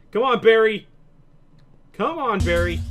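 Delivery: loud call to a teddy bear. Real, not acted. Calling the teddy bear to follow.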